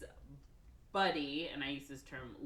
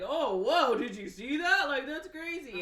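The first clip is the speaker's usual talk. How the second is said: gawking scientists; 10 semitones higher, 11 dB louder than usual. Retold or imitated. Scientists voiced watching the accident.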